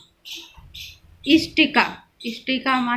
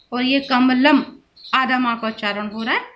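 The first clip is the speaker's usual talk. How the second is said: usual speaking voice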